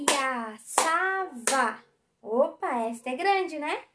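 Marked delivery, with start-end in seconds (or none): 0.1-1.8 "Piaçava" very slow, Pi - a - ça - va; four drawn-out beats on "Piaçava"; syllable by syllable. Shown as a demonstration. Teacher counts a long word, "Piaçava" split into Pi, a, ça, va. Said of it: "Quatro sílabas".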